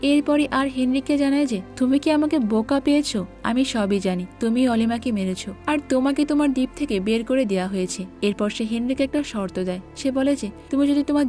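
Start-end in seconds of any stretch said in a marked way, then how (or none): none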